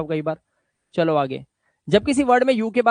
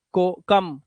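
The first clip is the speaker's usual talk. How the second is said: normal talking voice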